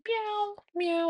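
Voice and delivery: put-on very high, babyish meow; toddler meowing. Mom imitating her toddler meowing at bedtime, very high babyish meow. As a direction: squeak it out like a tiny kid playing cat. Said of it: "and he's sitting there".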